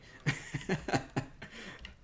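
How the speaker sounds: breathy giggle at own joke; amused airy laugh. Real, not acted. Laughs about MSG joke.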